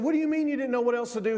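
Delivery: quick, high-pitched baffled question; retold surprise. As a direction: fire it back in mild disbelief. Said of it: "took the man by surprise".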